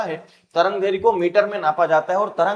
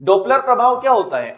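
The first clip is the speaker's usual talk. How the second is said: normal talking voice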